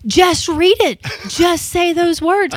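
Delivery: a fed-up coach, done waiting; exasperated coworker. Retold or imitated. Imitating Dave losing patience in the booth, exasperated. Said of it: "You were so exasperated with me".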